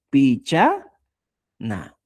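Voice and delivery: PICHANA slowly, split as "picha" and "na"; syllable by syllable. Shown as a demonstration. Teacher writing out the word, syllable by syllable. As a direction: draw out PICHANA with a break before "na". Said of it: "Y voy poniendo".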